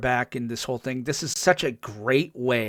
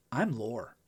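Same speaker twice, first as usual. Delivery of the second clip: sly Lore; 2 semitones lower, 8 dB quieter than usual. Retold or imitated. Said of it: "his whole demeanor changes".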